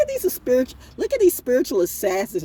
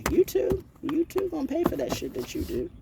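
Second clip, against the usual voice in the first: mocking disbelief; 11 semitones higher, 4 dB quieter than usual. Mocking question about YouTube pay.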